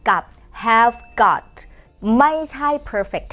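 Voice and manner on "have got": has got said very slowly and carefully; slow model phrase. Shown as a demonstration. Teacher modelling British has got slowly. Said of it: "เขาจะเติมคำว่า got เข้าไปข้างหลังด้วย".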